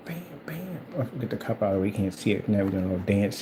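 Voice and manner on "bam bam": soft unvoiced whisper; whispered to himself while painting. Real, not acted. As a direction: breathe it out under your breath. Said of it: "whispered while painting".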